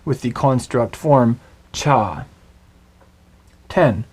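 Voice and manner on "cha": "cha" squeezed into a single syllable; compact sound. Shown as a demonstration. Teacher shows the construct form of nine, "cha" as one compact sound. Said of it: "it is a compact sound".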